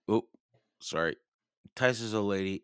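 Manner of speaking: usual speaking voice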